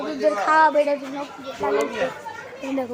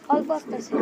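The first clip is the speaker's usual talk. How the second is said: hoarse voice. Real, not acted. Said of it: "গলা ভেঙে গেছে".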